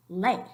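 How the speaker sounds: LEY as a single syllable, E and I glided together; one-syllable ley. Shown as a demonstration. Teacher showing students how it would sound without the accent, wrongly one-syllable ley. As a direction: say ley with the vowels run into one diphthong. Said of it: "would be pronounced".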